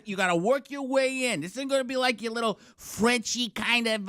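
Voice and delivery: rally them in Boston-speak; pushy pep talk in a Boston accent. Acted. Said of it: "that's Boston".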